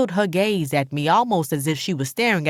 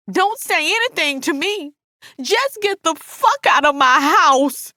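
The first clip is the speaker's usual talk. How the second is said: high, furious order to leave; high-pitched angry outburst; enraged Alice; 11 semitones higher, 3 dB louder than usual. A narrator's reading. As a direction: throw her out, voice rising in pitch and force. Narrator voices enraged Alice.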